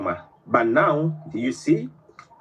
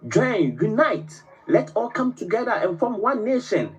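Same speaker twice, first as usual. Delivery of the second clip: higher, imitating a leader's rallying cry; rallying call; 9 semitones higher than usual. Libyan president urging African countries to unite, a high rallying call. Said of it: "talking to African countries".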